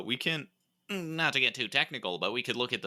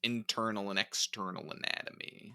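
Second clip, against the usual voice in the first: oddly hushed delivery; 7 dB quieter than usual. Acted. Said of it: "Why would you say it like that?".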